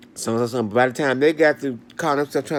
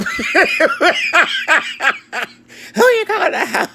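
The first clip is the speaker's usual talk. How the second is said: high, loud, laughing mock yell; retold yell; 7 dB louder, slower than usual. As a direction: yell it back at them, half laughing. Retelling a confrontation in a store.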